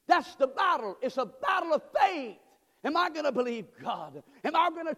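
full-force preaching. Acted.

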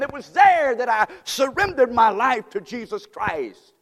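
building to a loud, high preaching shout; fiery revival preaching; full-force preaching. Acted. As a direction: preach it with rising fire. Preaching Paul's conversion at full force.

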